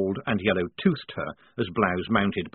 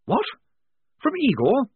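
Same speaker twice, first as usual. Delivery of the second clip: alarmed; 5 dB louder than usual. A narrator's reading. Blouse alarmed.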